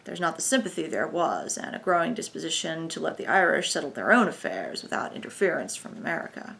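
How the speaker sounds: put-on American accent. A narrator's reading. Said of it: "with a transatlantic intonation".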